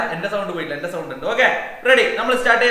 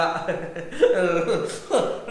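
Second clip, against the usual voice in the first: amused laughing to oneself; real chuckle. Real, not acted. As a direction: break into a chuckle mid-lesson. Teacher laughs after stumbling on word.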